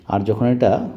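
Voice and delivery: usual speaking voice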